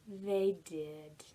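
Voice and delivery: ordinary delivery, nothing marked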